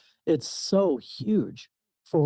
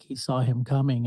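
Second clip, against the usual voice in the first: ordinary delivery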